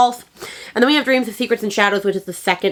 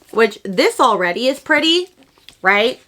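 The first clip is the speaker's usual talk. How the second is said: ordinary talk, nothing marked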